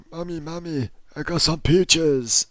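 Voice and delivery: put-on high, slow little boy calling out; a kid's sing-song calling out; excited little kid. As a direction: call to mum like a proud kid. Kid voiced calling to his mum.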